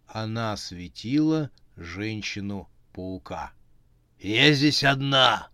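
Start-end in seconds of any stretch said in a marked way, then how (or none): 4.2-5.5 slow, loud, wheezing rasp; hoarse rasp. A narrator's reading. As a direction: rasp it out like a monster. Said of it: "прохрипела она".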